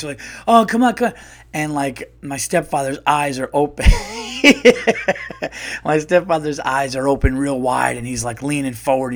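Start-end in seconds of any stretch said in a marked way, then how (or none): 0.5-1.1 high, loud, tense pleading; acted game-night urgency; intense urging. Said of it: "it's getting intense". 3.9-5.8 high, breathy burst of laughter; real uncontrolled giggling; helpless laughter. Real, not acted. Cracks up telling the story.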